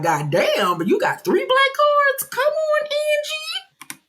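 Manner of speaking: high squealing disbelief at the cards; shocked disbelief. Retold or imitated. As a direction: shriek it in amazed disbelief. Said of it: "But I was like".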